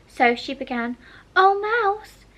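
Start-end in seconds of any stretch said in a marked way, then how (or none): 1.4-2.2 high, louder formal address; calling the mouse. A narrator's reading. Alice hails the mouse in the pool, high and formal. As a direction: call out grandly to a mouse.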